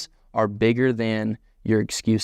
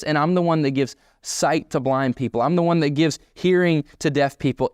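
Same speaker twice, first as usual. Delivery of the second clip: preaching at full force; 6 semitones higher than usual. Acted. Preacher voicing God to doubting Moses, fervent and lifted. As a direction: proclaim God's authority over Moses.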